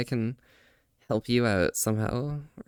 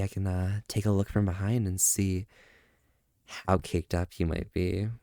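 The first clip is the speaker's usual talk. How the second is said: flirty teasing; 8 semitones lower, 4 dB quieter than usual. Acted.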